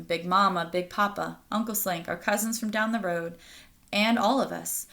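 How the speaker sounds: plain reading voice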